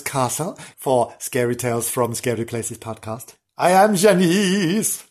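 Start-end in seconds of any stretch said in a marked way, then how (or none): 3.6-5.1 lightly put-on, slow, higher Janice voice. Presenter introducing herself as Janice, in a lightly put-on voice. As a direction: announce yourself slowly as a dotty old lady.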